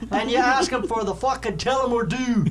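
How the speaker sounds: loud, high Irish bartender imitation; hammed-up Irish brogue, high and loud; strong Irish accent. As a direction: belt out the order like an insistent Irish barman. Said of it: "Exactly how he said it".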